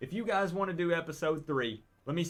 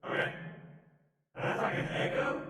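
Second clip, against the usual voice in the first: spirit voice. Shown as a demonstration. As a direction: ghostly echo filter. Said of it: "A spirit voice".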